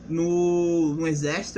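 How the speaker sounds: ordinary delivery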